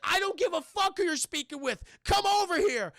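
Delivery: high, loud furious demand; angry shout. Retold or imitated. Chad yells at the doctor to come over, angry shout. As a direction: bark it at the doctor.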